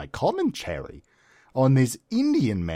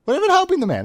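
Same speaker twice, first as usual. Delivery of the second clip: much higher, outraged; comic disbelief, pitched way up; indignant; 6 dB louder, much faster than usual. Real, not acted. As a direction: stress how they just left him. Indignant repeat in comic story.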